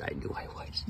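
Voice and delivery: breathy little whisper for the cat. Pretend. Owner voicing her cat's reply in a whisper. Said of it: "She says".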